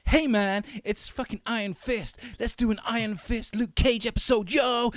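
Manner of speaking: mock an over-eager hero greeting a buddy; Luke Cage. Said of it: "So Luke Cage is coming".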